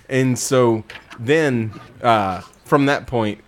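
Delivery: usual speaking voice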